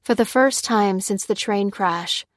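usual speaking voice

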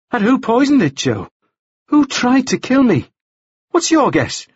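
high, heated woman's voice; roused woman character. A narrator's reading. Woman asking the man who tried to kill her, roused. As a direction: fire the questions back in a high voice.

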